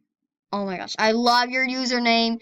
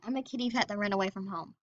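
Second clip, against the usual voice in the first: ordinary delivery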